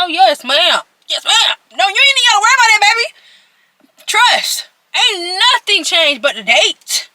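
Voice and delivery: high, loud, eager yes ma'am; eager high promise. Retold or imitated. Daughter eagerly promising her mom, loud and high. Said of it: "I was like".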